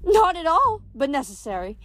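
high, breezy lady's voice; gushing river goddess, amused. A narrator's reading. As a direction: dismiss the worry, then usher her along.

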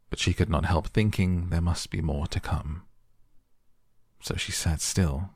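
ordinary delivery, nothing marked

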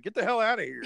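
high-pitched mock kick-out; retold angry dismissal. Retelling Daniel kicking his friend out, mock angry. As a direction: throw it out as an imitated, sudden order.

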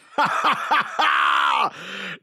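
high, long shouted laugh; booming laugh. Acted. Quoting a beloved space movie, roaring laugh. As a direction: roar with theatrical laughter.

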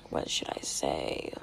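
whispered aside. Real, not acted. Vlogger unsure of the date, muttering in a whisper to herself. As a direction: drop to a private whisper.

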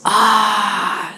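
long, held mock-glorious "ah"; glorious "ah" sound. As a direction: imitate a laptop waking with a drawn-out "ah". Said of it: "it makes this glorious".

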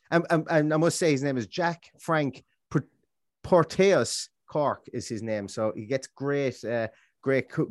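usual speaking voice

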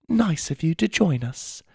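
put-on high, polite voice with British accent; British-accented girl. A narrator's reading. Girl with books curtsies to newcomer, polite British accent. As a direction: greet primly in a British accent. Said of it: "she said with an accent".

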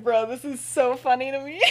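amused, breaking into a laugh; gleeful amusement. Acted. Sister grinning at the family drama, gleeful. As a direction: say it grinning, about to crack up. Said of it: "grinning. And she's like".

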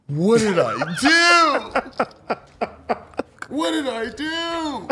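high, slow, desperate "What did I do?"; panicked pleading. Retold or imitated. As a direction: plead in stunned disbelief. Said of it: "I said".

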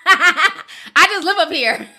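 slow, laughing through it. Real, not acted. To chat, laughing at her own joke. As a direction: giggle the words out.

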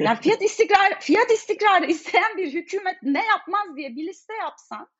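fed-up pitch jump; exasperated. Real, not acted. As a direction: voice rising in disbelief and frustration. Exasperated rant about government policy.

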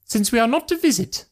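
very high-pitched, resentful mother voice; put-on high voice, full of resentment. A narrator's reading. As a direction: voice an indignant mother, pitched right up. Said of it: "said her mother resentfully".

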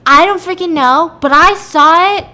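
loud, high acted exasperation; exasperated insistence. Witness pressed by doubting police, loud exasperated insistence. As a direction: insist at the top of your voice.

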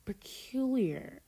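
slow, high, puzzled and feeble; feeble frown. Acted. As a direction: taste it and wince weakly. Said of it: "I said with a feeble frown".

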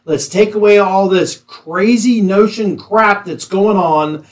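normal talking voice

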